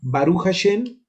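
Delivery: ordinary talk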